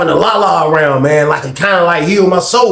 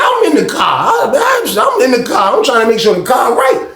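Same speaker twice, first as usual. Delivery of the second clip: acted protest; 6 semitones higher than usual. Acted argument over the car.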